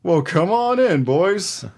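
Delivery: loud, high, hammed-up welcome; gullible homeowner. Host playing homeowner inviting the boys in, loud mock welcome. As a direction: boom it out as if completely won over.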